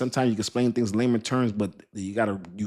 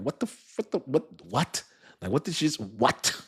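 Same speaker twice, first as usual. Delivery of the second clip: mild mock disbelief; baffled reaction; 4 semitones higher than usual. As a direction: play the confused viewer, half-muttered. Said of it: "People like".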